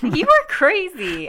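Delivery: high, slow and drawn out; exasperated disbelief. Real, not acted. Friend losing a debate over an actor's looks, exasperated disbelief. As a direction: stretch each word in exasperation.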